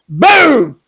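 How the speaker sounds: loud, drawn-out impact word; explosive boom. Retold or imitated. Storyteller acting out the snake's bite, explosive boom. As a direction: burst out with it like a sudden strike.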